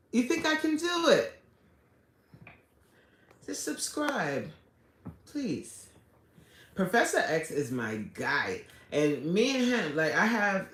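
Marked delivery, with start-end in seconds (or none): 0.1-5.9 high, coaxing little voice; playful plea. Real, not acted. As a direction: beg sweetly for a subscribe. Pleading with viewers to subscribe.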